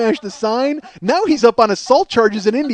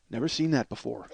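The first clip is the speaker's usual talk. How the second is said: very low, hushed deadpan; Paul Lane; 11 semitones lower, 13 dB quieter than usual. Retold or imitated. Club man strolling in from the chaos, soft deadpan. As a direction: say it softly, completely unfazed.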